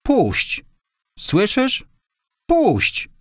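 very high-pitched cry of protest. A narrator's reading. Girl demanding the boy release her, crying out. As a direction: cry out sharply, pitch leaping far up. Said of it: "krzyknęła dziewczyna".

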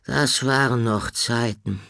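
mildly wistful character voice. Acted. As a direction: remember good old days with longing. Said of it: "sagte Horn wehmütig".

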